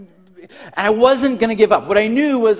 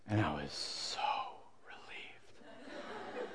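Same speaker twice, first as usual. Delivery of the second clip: whispered, drawn-out relief; hushed relief; 23 dB quieter, much slower than usual. Retold or imitated. Kid reliving the end of the fight, hushed relief. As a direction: let it sink away into a whisper.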